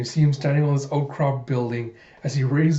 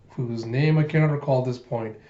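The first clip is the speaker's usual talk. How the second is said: plain reading voice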